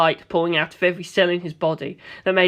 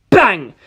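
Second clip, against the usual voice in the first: loud shouted bang; gunshot; 7 dB louder than usual. Acted. Narrator voicing his own gunshot loudly. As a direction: punch out the gunshot.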